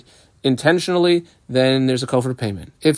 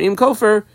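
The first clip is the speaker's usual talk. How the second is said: "Im" lifted high before Kofer; stress on Im; 8 semitones higher than usual. Shown as a demonstration. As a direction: mark the word "Im" with raised pitch. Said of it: "emphasis on the word Im".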